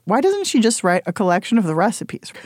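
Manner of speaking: ordinary delivery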